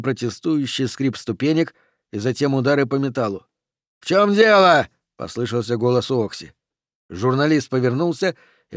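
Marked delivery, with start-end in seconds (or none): 4.0-4.9 loud, high demanding shout; calling out. Acted. Oxy calls to the others on the ladder, shouting. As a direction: shout a demand to know what's happening.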